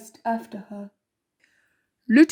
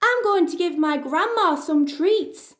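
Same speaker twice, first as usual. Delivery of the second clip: put-on high, light child's voice; a sweet little girl answering; 6 semitones higher than usual. Girl tells the wolf her plan, high and innocent.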